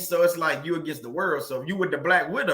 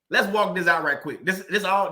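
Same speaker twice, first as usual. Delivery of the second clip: ordinary talk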